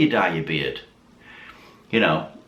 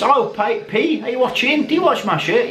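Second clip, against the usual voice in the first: calling out; 13 semitones higher than usual. Real, not acted. Calling out to viewer Patimus.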